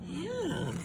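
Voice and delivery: ordinary delivery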